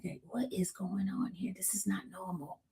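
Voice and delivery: very soft, worried whisper. Retold or imitated. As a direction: lean in and whisper the alarm. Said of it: "we whispering".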